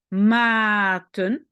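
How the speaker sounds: "Maten" with a long loud a; open-syllable demo. Shown as a demonstration. Teacher shows learners an open syllable, drawn-out demo. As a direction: hold the a of "Maten", then add ten. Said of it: "syllabe ouverte".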